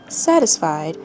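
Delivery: usual speaking voice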